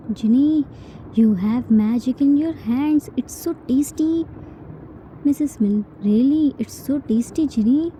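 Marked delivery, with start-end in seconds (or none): none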